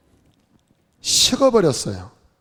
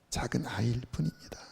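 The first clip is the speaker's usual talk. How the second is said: much softer whisper; whispered confession; 4 semitones lower, 13 dB quieter than usual. Retold or imitated. Preacher repeats the brother's confession in a whisper. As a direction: let it fall to a whisper.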